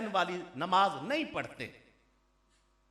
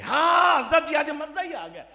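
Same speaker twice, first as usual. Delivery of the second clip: mock-delighted fan, higher-pitched exclamation; gushing admirer; 6 semitones higher than usual. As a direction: imitate a listener gushing over the preacher. Mimics gushing admirer after the sermon. (ਨਕਲ ਲਾਹੁੰਦੇ ਹੋਏ ਖੁਸ਼ੀ ਨਾਲ ਵਾਹ-ਵਾਹ)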